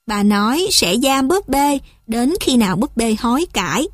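ordinary delivery

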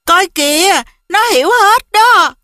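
high, thrilled outburst; amazed and tickled, voice lifted high; delighted exclamation. A narrator's reading. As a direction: exclaim with delight, pitched well up. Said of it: "Cô ấy thốt lên thích thú".

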